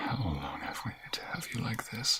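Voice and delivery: soft airy whisper; tender whisper. Acted. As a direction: hold her close and whisper. Embracing lover.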